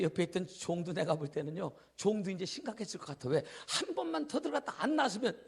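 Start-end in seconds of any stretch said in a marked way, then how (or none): none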